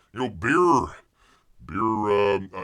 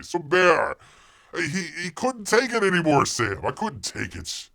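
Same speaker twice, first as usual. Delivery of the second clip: ordinary talk, nothing marked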